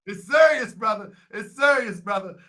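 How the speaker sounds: it's serious, loud and pressing; loud insistence. Real, not acted. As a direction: press the point hard to a brother. Insists to the caller.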